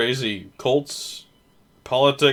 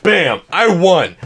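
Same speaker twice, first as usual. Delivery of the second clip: usual speaking voice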